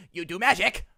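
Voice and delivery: high, loud goblin squawk. Acted. Goblin questioning Jericho about magic. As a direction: ask it like a nosy goblin. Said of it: "looks at you and says".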